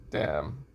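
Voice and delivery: long breathy sigh, then a low "damn"; resigned sigh. Acted. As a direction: sigh it out and drop the voice. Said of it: "Issei sighs and says".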